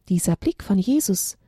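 ordinary delivery, nothing marked

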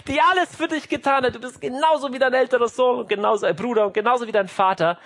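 high, loud whiny lament; tearful mother. Acted. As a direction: play a self-pitying mother wailing at her child. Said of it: "Mama wird weinerlich, fängt an". (weinerlich jammernde Mama)

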